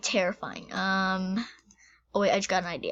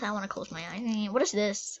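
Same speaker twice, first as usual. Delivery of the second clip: ordinary talk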